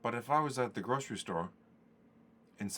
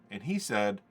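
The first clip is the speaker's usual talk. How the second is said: usual speaking voice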